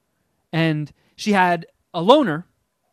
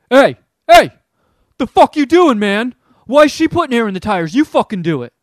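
loud, high, angry yell from a stranger; retold yelling; 6 semitones higher, 10 dB louder than usual. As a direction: yell it at him like a furious guy at the pump. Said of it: "And starts, like, yelling at me".

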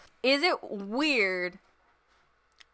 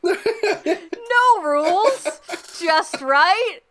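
excited laughing; 6 semitones higher, 4 dB louder, much slower than usual. Real, not acted. Excited about being a dad.

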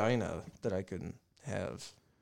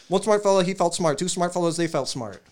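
fast tongue twister; 6 semitones higher, 5 dB louder than usual. Real, not acted. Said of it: "Let me try".

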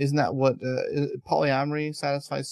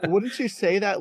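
laughing; 6 semitones higher than usual. Real, not acted. Laughing after failed accent.

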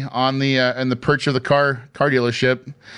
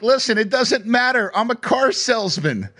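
cocky brush-off; 9 semitones higher than usual. Retold or imitated. Cocky answer to wife's doubts.

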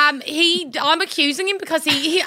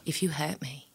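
whisper; 12 semitones lower, 14 dB quieter than usual. Acted.

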